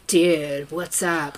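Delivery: low-pitched mock Californian dude; California accent. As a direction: put on a laid-back California accent. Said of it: "or Valley Girl".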